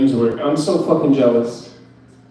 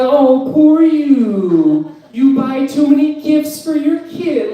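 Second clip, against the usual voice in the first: much higher, very slow pitying mother-in-law voice; mock rich mom; 10 semitones higher, slower than usual. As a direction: lay on fake sympathy like a snooty mom. Comic voicing girlfriend's rich mom.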